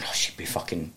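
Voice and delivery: hushed, breathy whisper to himself; barely voiced, as if ashamed; whispered thought. Sitting alone picturing his disapproving nana, whispering.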